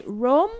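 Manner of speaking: "Rom?" with a long rising question tone; unsure pronunciation. Shown as a demonstration. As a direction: try out "Rom?" as a guess, pitch sliding up. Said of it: "don't know how you pronounce".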